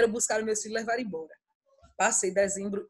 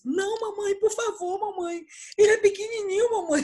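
put-on high, pleading seven-year-old voice; pleading little boy; 9 semitones higher, 3 dB louder than usual. Younger son begging mother not to hit brother. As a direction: beg like a small child for his brother. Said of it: "Aí vem Rudá com sete anos".

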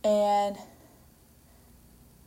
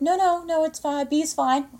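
higher-pitched, placating retreat; hasty backing down; 7 semitones higher than usual. Retold or imitated. Movie mom accepting the B from teacher, backing down. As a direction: back off in a raised pitch. Acts mother hastily backing down.

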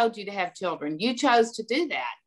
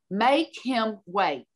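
slow, measured repeat of an order; stern command; much slower than usual. Retold or imitated. Headmistress repeating it to baffled parents, stern and slow. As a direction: space the words out firmly. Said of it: "and I mean it".

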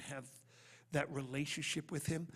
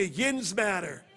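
put-on Pittsburgh accent; 3 semitones higher, 8 dB louder, much slower than usual. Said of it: "say it like you're from Pittsburgh".

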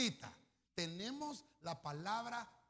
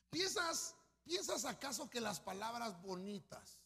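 enemy's taunt; 4 dB louder, slower than usual. Acted. Preacher voices enemy Rabsacés.

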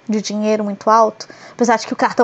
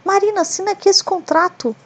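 lightly voiced, higher, sweet-talking persuader; a hint of a smooth talker; 5 semitones higher than usual. People tricking the trusting host, mildly sugary persuasion. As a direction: coax her into signing, mock-friendly. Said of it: "As pessoas vêm assim, ó".

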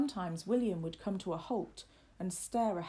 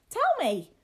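put-on high, loud boyish demand; crying out; 12 dB louder than usual. A narrator's reading. Boy cries out to the retreating lad, high and loud. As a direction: burst out like an impatient young master. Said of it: "cried William".

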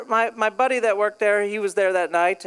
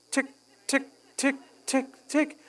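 ticking clock imitation; 3 semitones higher, 6 dB quieter than usual.

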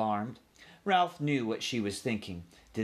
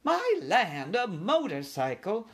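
put-on high, loud old-lady exclamation; scandalised elderly aunt, pitched high; 10 semitones higher, 4 dB louder, slower than usual. A narrator's reading. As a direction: exclaim like a shocked old auntie. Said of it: "said old Aunt Dorothy".

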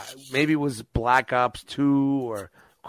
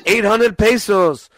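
higher, louder mock awe; mock excited workers; 5 semitones higher, 5 dB louder, much slower than usual. Mimicking workers pricing his comics, mock excited accent. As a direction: gush over the comics. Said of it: "imitating workers".

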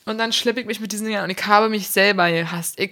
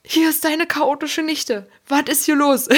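aufgekratzte Nichte; 6 semitones higher than usual. Retold or imitated. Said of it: "meinen Onkel gerufen".

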